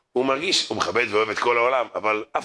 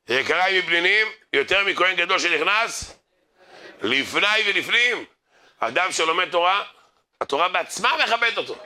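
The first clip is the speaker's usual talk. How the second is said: raised-pitch, emphatic sermon delivery; exalted recitation rising above chatty talk; preaching; 6 semitones higher than usual. Real, not acted. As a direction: proclaim the verse, stretching the climax.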